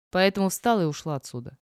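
plain reading voice

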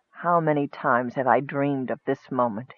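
low, soft musing; hushed, rueful reflection; bitter inner thought. A narrator's reading. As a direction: think aloud with quiet bitterness. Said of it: "he thought to himself bitterly".

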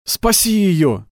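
высокий протяжный отчаянный крик; кричи медленно, из последних сил. A narrator's reading. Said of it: "собрав последние силы, закричал он". (отчаянно закричать)

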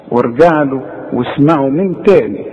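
ordinary talk

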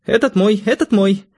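put-on very high, eager teenage daughter; high-pitched girlish excitement; excited exclamation. A narrator's reading. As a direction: claim it at once, thrilled. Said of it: "воскликнула Надя".

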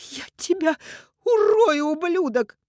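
very high, loud outburst; furious threat. A narrator's reading. Mother threatens her son's killer, furious. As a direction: explode into a shrieked threat. Woman threatens him in fury.

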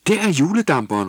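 high, loud excited call; sudden shout. Acted. Tulle spotting the Christmas steamer, loud excited shout. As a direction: call out pointing at the boat. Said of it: "råbte Tulle pludselig".

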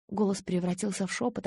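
usual speaking voice

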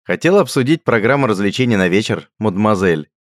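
loud teasing mockery; mocking. A narrator's reading. Richard teasing Radgar, loud mock courtesy. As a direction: needle him with mock courtesy. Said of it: "продолжил насмехаться Рихард".

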